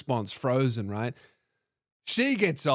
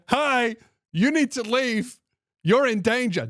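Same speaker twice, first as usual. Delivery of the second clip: higher-pitched, drawn-out urgent warning; acted warning; 8 semitones higher than usual. Warning a stranger to leave the train, acted urgent warning. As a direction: warn a stranger carefully, pitched up.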